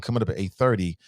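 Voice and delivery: ordinary talk, nothing marked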